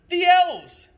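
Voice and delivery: put-on excited kid shouting; child's shout. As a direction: shout with wonder.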